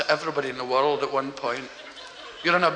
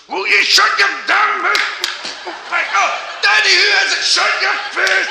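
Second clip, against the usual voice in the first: much higher, louder angry shouting; yelling father; 12 semitones higher, 5 dB louder, slower than usual. Acted. Father yelling at his kids behind door, angry shout. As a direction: bellow at the noisy kids.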